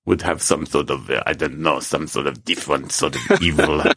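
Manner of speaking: low, slightly hammed-up evil voice; lightly sinister villain. Podcaster imagining an evil scammer's accent, lightly sinister. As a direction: drop low for a hint of villainy.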